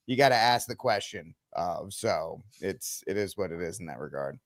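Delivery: ordinary talk, nothing marked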